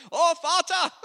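loud, high mock German bark; hammed-up German shout in prayer; aggressive German. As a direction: bellow it like an angry German. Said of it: "aggressive language".

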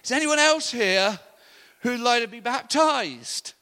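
high, loud call to a crowd. Retold or imitated. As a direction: put the question out to everyone, drawn out at the end. Calling out to pool crowd.

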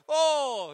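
high, loud theatrical "oh"; mock dramatic oh. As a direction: gasp it out like a melodrama. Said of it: "And by the way".

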